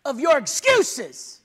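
loud, slow, high commanding shout; bold shout. Acted. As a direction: shout them out of their excuses. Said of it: "Because someone says".

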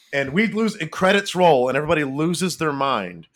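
high, loud burst of excitement; thrilled outburst. Real, not acted. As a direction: erupt like a fan at the final shot. Imagining the thrilled reaction to ending.

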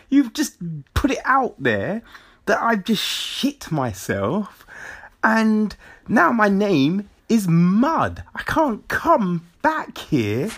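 high-pitched, hammed-up indignation; acted exasperated complaint. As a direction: scold a friend for ruining your name, playing it up. Acts out telling friend off for humiliation.

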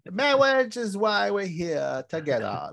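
high, drawn-out comic clergyman with mangled consonants; funny preacher. Retold or imitated. Imitating the funny preacher at the wedding, comic high voice. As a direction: stretch it out high like a silly, speech-impeded cleric. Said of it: "there's that funny, that funny, uh, preacher".

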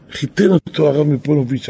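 usual speaking voice

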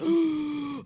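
high shocked gasp; sharp gasp. Retold or imitated. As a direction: suck in air in alarm. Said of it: "I was like".

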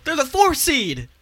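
excited. Real, not acted. Excited about the Knicks' ranking.

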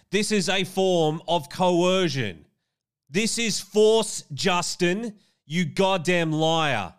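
loud, high, drawn-out denunciation; real indignant outburst, words stretched out; angry accusation. Real, not acted. Denounces Justin as a liar.

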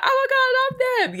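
high-pitched fangirl squeal; gushing little girl. Acted. Little girl gushing over her favourite band, excited squeal. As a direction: squeal it like a besotted little fan. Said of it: "you'd be like".

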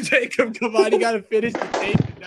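high, loud laughter through words; can barely talk for laughing; laughing hard. Real, not acted. Host begging a friend to finish, laughing.

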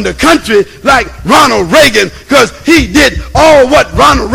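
loud, fired-up preacher; preaching at full force. Acted. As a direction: build the sermon to a shout.

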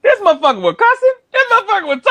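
high-pitched mimicry of a ranting nephew; cussing nephew. Uncle mimicking nephew's cussing review show, high-pitched rant. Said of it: "standing at his door listening".

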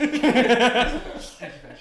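slow, high laughing drawl. Real, not acted. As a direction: stretch it out while laughing. Laughing drawn-out echo.

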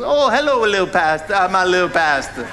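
playful old-lady impression; imitated elderly churchgoer. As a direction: mimic an old lady cooing over her pastor. Imitates elderly woman greeting him.

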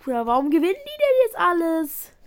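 high, loud complaint; exasperated outburst. Real, not acted. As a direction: complain loudly. Frustration at game.

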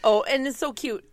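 ordinary delivery

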